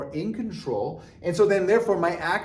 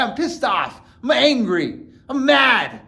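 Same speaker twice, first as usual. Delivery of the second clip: rising higher and louder into a mad outburst; mock anger building to a higher, louder peak; 6 semitones higher, 4 dB louder, slower than usual. Shown as a demonstration. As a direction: escalate from pissed to mad. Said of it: "Look at my".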